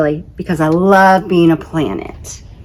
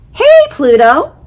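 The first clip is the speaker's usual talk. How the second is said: high, loud friendly hail; Uranus calling out to Pluto; 10 semitones higher, 6 dB louder than usual. Acted. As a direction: shout across space in greeting.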